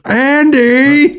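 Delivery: high squealing Andy call; Pee-wee yell. Retold or imitated. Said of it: "It's like".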